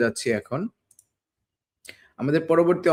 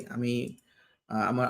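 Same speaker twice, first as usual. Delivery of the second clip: normal talking voice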